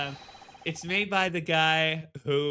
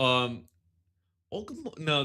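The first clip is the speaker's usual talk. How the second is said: usual speaking voice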